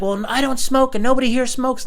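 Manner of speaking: loud, very high-pitched denial; mocked indignant neighbor. Neighbor denying anyone here smokes, indignant mock voice. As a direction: protest in a screechy put-on voice.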